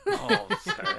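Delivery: high squeal of laughter; giggling sorry; laughing. Real, not acted. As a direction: apologise through a burst of laughter. Bursts out laughing.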